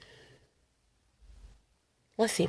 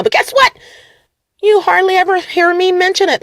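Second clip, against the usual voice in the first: excited; 10 semitones higher than usual. Real, not acted. Boasting about having no car.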